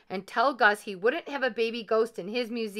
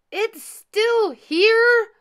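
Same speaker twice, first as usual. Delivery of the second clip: very high, very loud, drawn-out shout; furious cry; 14 dB louder, much slower than usual. A narrator's reading. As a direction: cry out in fury, stretching the words. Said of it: "he cried".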